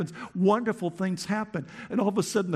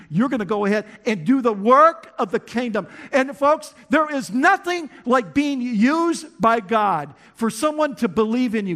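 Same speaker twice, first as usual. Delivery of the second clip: loud, higher, slowing preacher's cry; full-force preaching; 6 semitones higher, 7 dB louder than usual. Acted. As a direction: drive it home to the congregation. Preaching climax.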